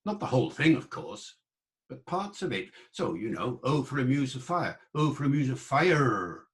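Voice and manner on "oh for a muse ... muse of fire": early modern English accent with strong curled R in "fire"; original pronunciation R. Shown as a demonstration. Lecturer demonstrating the R sound to the audience. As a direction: lean on the R after the vowel in "fire". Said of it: "that er sound".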